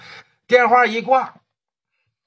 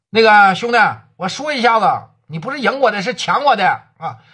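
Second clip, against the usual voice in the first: raised, higher voice, a burst of grievance; indignant protest; 8 semitones higher than usual. Acted. Zhuzi protesting to Wu Tie that he was robbed, indignant. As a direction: let it out, he robbed you.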